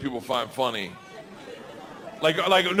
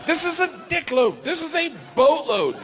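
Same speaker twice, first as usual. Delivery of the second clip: much higher, pitched-up disbelief; incredulous rant; 9 semitones higher than usual. Retold or imitated. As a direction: squeal out his outrage at the crowd. He rants about the Korean crowd.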